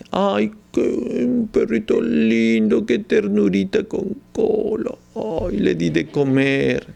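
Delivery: put-on high, slow, sugary baby talk to a puppy; doting pet lover. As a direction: coo over the puppy, drawn out and high. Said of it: "se ama con mucha ternura". (voz alta y lenta de quien mima a un perrito)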